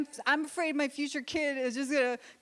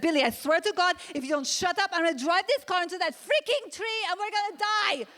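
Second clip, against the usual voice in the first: furious mom; 7 semitones higher than usual. Retold or imitated. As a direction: yell at the kid in the back seat. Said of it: "I'm just gonna be like".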